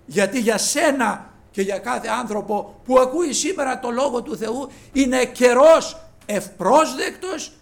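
loud, impassioned pulpit call, slowing on the key words; preaching at full force. Acted. Preacher urging listeners to accept God's word, loud preaching. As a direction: proclaim it to the whole church.

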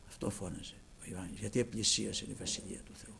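ordinary delivery

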